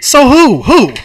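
high, loud questioning; acted frustrated outburst; exasperated demand. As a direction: shout the question impatiently. Acted script quarrel.

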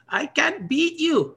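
put-on high, slow Filipino-accented voice. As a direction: imitate the old Filipino pro, slowly. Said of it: "his thick Filipino accent".

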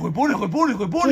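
a son mimics the men who harassed his mother, loud and leering. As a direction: ham up the men shouting at her stockings. Imitating men leering at mother's tights.

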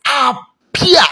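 high, rising call; called-out plea. Shown as a demonstration. Preacher showing what to say after shouting, high called-out plea. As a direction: cry the word out as a summons.

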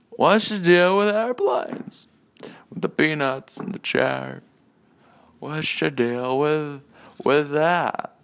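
high, slow stand-up comic impression; hammed-up comedian voice, stretching the words; Jerry. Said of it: "I'm Jerry".

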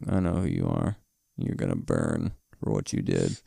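very low, quiet menace; deadpan mock threat. Dorothy stares down the caged nurse, low mock threat. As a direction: drop it low and soft like a dead-eyed threat. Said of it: "Dorothy's just dead eye staring her like".

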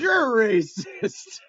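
very slow, high, loud laughing retort; mock retort. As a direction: throw it back laughing. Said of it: "And Desmond goes".

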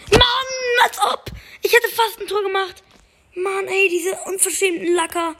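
high, exasperated yelp at the near miss; high-pitched gamer frustration, almost laughing; frustrated outburst. Real, not acted. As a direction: shriek it in disbelief, half laughing.

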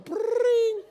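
high trilled telephone ring; imitated phone ring. Phone rings at five thirty, high trill. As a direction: trill it shrilly like a ringing phone.

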